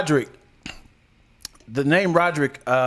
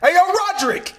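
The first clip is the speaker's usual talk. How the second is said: high-pitched street holler; calling out; 6 dB louder than usual. Acted. Showing how the name sounds yelled out, calling out. Demonstrates yelling the awkward name.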